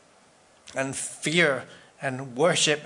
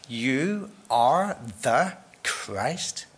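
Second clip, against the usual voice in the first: slow reverent; 2 semitones lower, much slower than usual. Acted. Peter's confession slowly restated.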